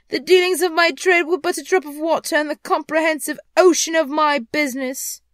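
loud, high, impassioned declaiming; anguished ghost. Acted. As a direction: thunder it out like a remorseful phantom. Ghost cries out in remorse.

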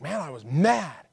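slow, drawn-out mock anger; lightly acted indignant resident; retold upset. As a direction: lean hard and loud on mad. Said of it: "he was upset".

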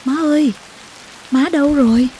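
high, loud, alarmed call for mother; urgent calling out. Acted. Child calls for mother in the dark, urgent high call. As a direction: call sharply for a missing mother. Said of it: "Tôi hướng sang giường em bé gọi giật".

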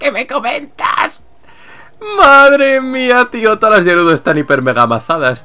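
high, loud disbelief; real astonished gushing; amazed outburst. Real, not acted. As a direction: exclaim it high, stretching the first words. Amazed at muscular characters.

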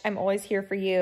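ordinary delivery

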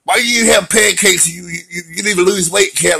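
half-joking scold at a chubby pet; mock scolding the cat. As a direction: scold the cat like a fed-up owner. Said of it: "I wanted to yell".

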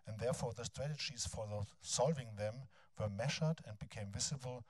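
ordinary talk

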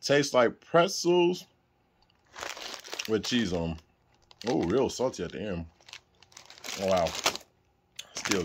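chewing while talking; talking between bites; mouth full of chips. Real, not acted. Seen 0: Ate a chip at -4; pursed, chewing mouth throughout.

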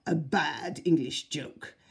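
put-on slow, sneering foreign accent; the Duke mocks the English in a foreign accent; Austrian accent sneer. A narrator's reading. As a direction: drag it out with scorn, foreign accent.

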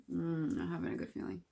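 talking around a mouthful; tasting while talking; mouth full, chewing. Real, not acted. Seen 0: Just tasted from spoon; food visible in mouth while speaking.

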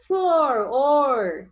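"flor, or" com o "or" forte e alongado; som final destacado. Shown as a demonstration. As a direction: isolate the ending of "flor", then a loud, drawn-out "or". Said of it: "tem que terminar com o sonzinho". ("flor, or" destacando o som final)

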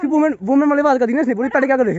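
loud, high-pitched protest; indignant outburst. Real, not acted. Vlogger protesting to viewers about a companion's remark, indignant. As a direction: throw the remark back, outraged.

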